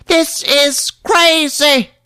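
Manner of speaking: very high, slow whine. Retold or imitated. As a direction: drag out the complaint squeakily.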